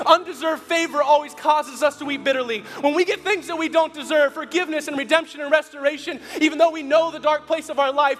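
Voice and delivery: impassioned preacher hitting the climax; preaching at full force. Acted. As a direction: preach it to the back row.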